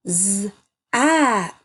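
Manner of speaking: Z-ack split into a buzzed Z, then ack; sounding out. Shown as a demonstration. Teacher helping children read Zach's name, sounding it out. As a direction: sound out Z-ack in two separate parts.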